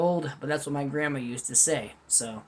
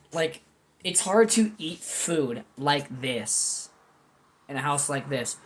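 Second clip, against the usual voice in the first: ordinary talk, nothing marked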